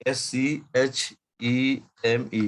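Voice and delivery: slow, spaced-out letters for note-takers; slow dictation. Real, not acted. Teacher spelling so every student can write comfortably, slow dictation. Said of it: "मैं बहुत धीरे धीरे बोल रहा हूँ".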